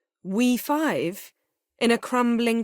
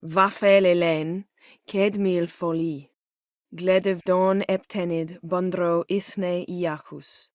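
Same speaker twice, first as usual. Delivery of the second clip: incantation; slower than usual. Acted. Slow spell incantation in Elder Speech.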